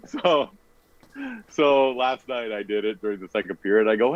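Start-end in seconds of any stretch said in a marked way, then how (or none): none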